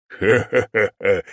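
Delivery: drawn-out villain cackle; slow evil demon laugh. Acted.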